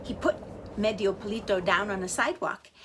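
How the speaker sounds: ordinary delivery, nothing marked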